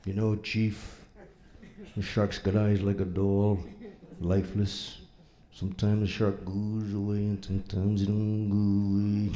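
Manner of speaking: low, quiet storyteller impression; Quint. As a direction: do a hushed, brooding sea-tale monologue. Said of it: "Quint, it's quiet".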